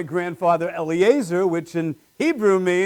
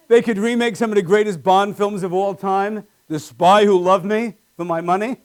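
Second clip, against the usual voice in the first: ordinary talk, nothing marked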